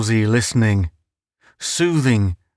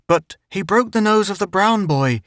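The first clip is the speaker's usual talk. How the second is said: lightly higher aunt voice; a hint of a fussing old aunt; aunt reporting to the father; 9 semitones higher than usual.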